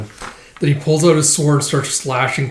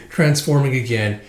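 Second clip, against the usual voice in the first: usual speaking voice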